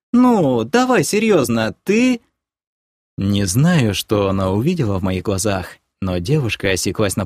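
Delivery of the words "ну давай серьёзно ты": put-on high-pitched offended girlfriend; hurt, drawn-out plea. A narrator's reading. Girlfriend begging him to be serious, hurt. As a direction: plead in a high, wounded voice. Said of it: "обиженно протянула".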